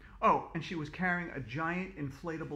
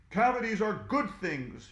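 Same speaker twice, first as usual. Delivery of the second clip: louder, higher villain's shout; villain shouts at the kids; 6 semitones higher, 4 dB louder than usual. Acted. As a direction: gloat it out like a cartoon baddie. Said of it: "shouted Captain Plack".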